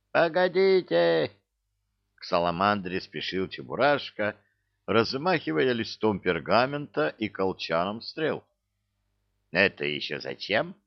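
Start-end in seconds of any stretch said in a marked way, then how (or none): 0.1-1.3 high, urgent call. A narrator's reading. Cheburashka hurrying to the salamander, calling out high. 9.5-10.7 puzzled, lower-pitched question; surprised question. A narrator's reading. Lizard's left head puzzled by the arrow, mildly surprised. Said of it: "удивилась левая".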